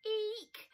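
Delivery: high loud eek; tickled squeal. Acted. Badger squealing at Mole's feet.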